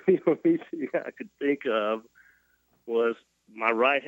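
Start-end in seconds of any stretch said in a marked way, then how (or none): 0.0-2.0 higher, laughing as he talks; amused laughing. Real, not acted. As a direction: chuckle through the telling. Laughing while telling his funny story.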